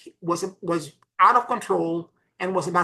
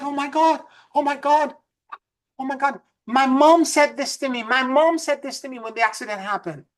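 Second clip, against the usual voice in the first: raised pitch, mock tearful exclamations; retold emotional outburst; 6 semitones higher than usual. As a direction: voice her overwhelmed realization, pitched higher. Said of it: "she started crying and bawling out crying".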